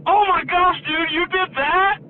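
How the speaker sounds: loud, very high-pitched fake horror; mock shock. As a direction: cry out in exaggerated disbelief to tease him. Mock shock to tease the kid.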